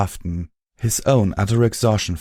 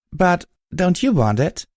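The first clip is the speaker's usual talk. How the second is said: surprised; 10 semitones higher than usual. A narrator's reading. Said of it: "I asked, surprised".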